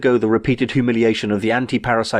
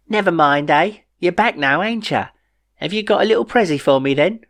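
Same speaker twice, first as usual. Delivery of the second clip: higher, sing-song coaxing; wheedling queen; 8 semitones higher than usual. A narrator's reading. Queen Shelley wheedles the men for a present, pitched high. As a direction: coax it like a fake sweet queen.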